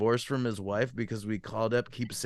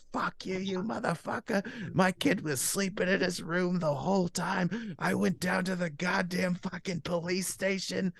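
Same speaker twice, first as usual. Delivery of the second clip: high, softer imitation of outrage; angry mother; 6 semitones higher, 4 dB quieter than usual. Mother to prank caller after the station trip, angry. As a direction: imitate a fuming mom in a raised pitch. Retold furious mother calls back.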